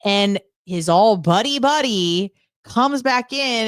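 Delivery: normal talking voice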